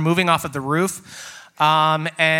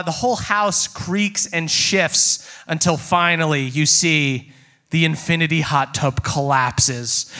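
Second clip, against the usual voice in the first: ordinary talk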